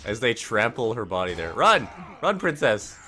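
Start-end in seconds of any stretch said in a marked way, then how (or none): 1.5-3.0 shouting. Acted. Urgent escape in game scene.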